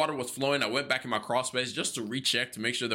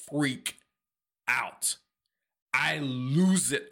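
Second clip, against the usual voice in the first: ordinary talk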